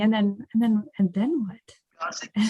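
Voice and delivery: stall, repeat, then drop to a hushed question; lost, the voice dying away to a whisper; trailing into uncertainty. Acted. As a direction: fade to a whispered "then what?". Mimes excitement fading into uncertainty.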